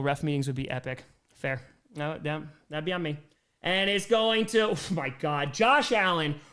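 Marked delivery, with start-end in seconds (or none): 3.6-6.4 slow, rising live call; announcer reacting live; excited play-by-play. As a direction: call the run as it happens.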